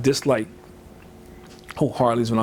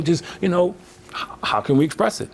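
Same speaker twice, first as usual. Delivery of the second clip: ordinary delivery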